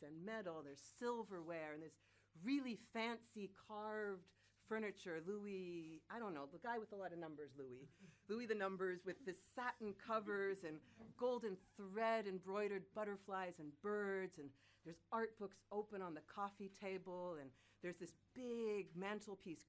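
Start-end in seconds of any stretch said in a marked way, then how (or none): none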